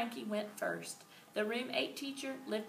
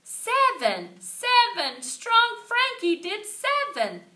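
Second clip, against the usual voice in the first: high, loud triumphant yell, slowing at the end; girl shouting a classmate's win; excited shout; 12 semitones higher, 13 dB louder, slower than usual. A narrator's reading. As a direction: cheer the number out. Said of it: "shouted new Thelma".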